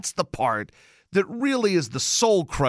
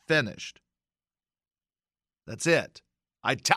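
ordinary delivery

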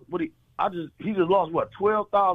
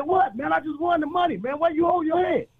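puzzled lawyer; 10 semitones higher than usual. Acted. Lawyer voiced in the joke.

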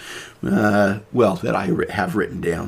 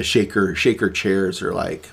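normal talking voice

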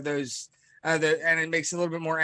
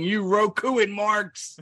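raised, drawn-out mock yell; mock scream; 4 semitones higher, 4 dB louder, slower than usual. Imagining MJF taunting the fans as marks, mock screaming. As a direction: scream it slowly in mock outrage. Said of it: "He'd be screaming".

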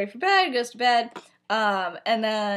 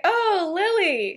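high, drawn-out mock surprise; slow, swooping high greeting; feigned surprise; 9 semitones higher, 4 dB louder, much slower than usual. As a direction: pretend you just stumbled on someone. Acting out feigned surprise encounter.

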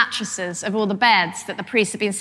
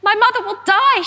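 high, louder cry of alarm; girl's alarmed cry; 12 semitones higher, 4 dB louder, faster than usual. Retold or imitated. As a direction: warn them like a panicked child. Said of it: "she stood up and she said".

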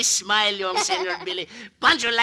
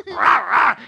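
louder mock growl; wolf growl; 6 dB louder than usual. Wolves eat the animals, mock growl. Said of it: "eaten by the wolves".

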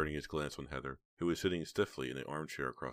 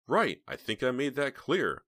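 high, loud, slow emphasis; emphatic indignation; 9 semitones higher, 7 dB louder, slower than usual. A narrator's reading. Heather confirms she wants him gone, emphatically indignant. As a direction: spell it out indignantly.

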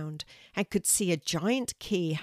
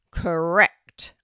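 loud, resounding verdict; booming tree teacher; 9 dB louder than usual. A narrator's reading. Oak tree confirms Kelty's spelling, booming. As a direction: boom it out as the oak. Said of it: "said the teacher of the oak tree".

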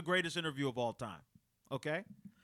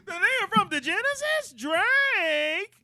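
mocking rant; 9 semitones higher than usual. Rapper ridiculing a rival on air, mocking rant. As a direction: ridicule him rising. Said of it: "They were like".

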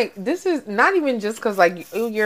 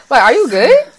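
higher, louder incredulous question; mock concern; 5 semitones higher, 6 dB louder than usual. Friend mocking the slapped man, incredulous and loud. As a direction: ask it like you can't believe him.

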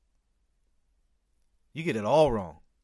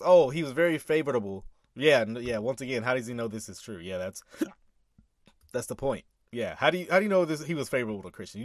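ordinary talk

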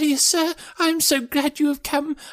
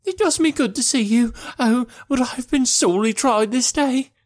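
put-on high-pitched distressed old woman; tearful, overwrought. Housekeeper pours out her troubles to a visitor, high tearful voice. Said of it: "she cried with a hysterical sob".

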